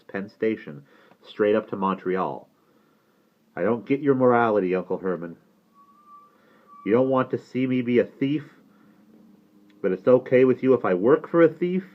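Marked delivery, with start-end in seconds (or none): 3.6-11.8 restrained, accusing questioning; challenging nephew. Acted. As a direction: needle your uncle about his double standard. Nephew challenges his uncle.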